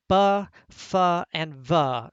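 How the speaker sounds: b, f and v each sounded with a short "uh"; consonant sounds demonstrated. Shown as a demonstration. Professor showing students what the trills became, sounded out. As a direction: sound out b, f, v as buh, fuh, vuh.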